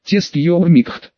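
ordinary delivery

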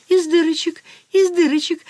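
high, giggly nervous repeat; nervous giggle. Acted. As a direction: titter it out nervously. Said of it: "нервно захихикал маркиз".

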